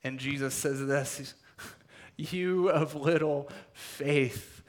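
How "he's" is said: ordinary delivery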